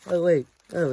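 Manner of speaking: ordinary delivery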